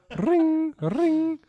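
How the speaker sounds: high ringing strum noise; imitated guitar strum. Showing off a guitar app, imitated strum. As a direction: mimic a guitar chord with your voice.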